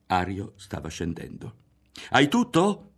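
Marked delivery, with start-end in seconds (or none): 2.1-2.8 high loud call after him. A narrator's reading. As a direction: shout it down the stairs. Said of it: "gli gridò dietro Berto". (gridato dietro, alto)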